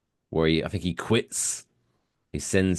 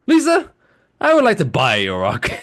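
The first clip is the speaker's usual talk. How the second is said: far higher, louder cartoon dad; Homer; 10 semitones higher, 7 dB louder than usual. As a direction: blurt the offer in a goofy put-on voice. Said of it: "And I was like".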